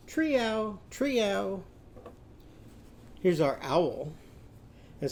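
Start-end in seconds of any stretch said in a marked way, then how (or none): 0.1-1.6 high drawn-out "treow", twice; cat's meow with TR. Shown as a demonstration. Teacher blends Timmy and Rosie's cats, high meowed "treow". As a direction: mew it high with a TR in front. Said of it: "what's it going to be?".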